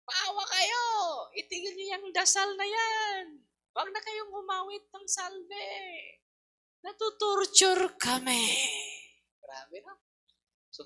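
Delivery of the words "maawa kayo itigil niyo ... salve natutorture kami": high, loud crying demon shriek, slowed; tormented demons. Retold or imitated. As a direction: wail like demons burned by the hymn. Demons scream during hymn.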